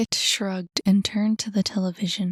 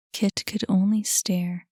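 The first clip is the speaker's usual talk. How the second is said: ordinary delivery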